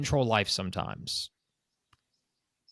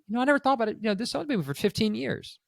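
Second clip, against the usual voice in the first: lightly voiced surprised owner, higher; mock the owner who just realised it, pitched up; 7 semitones higher than usual. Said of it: "they go".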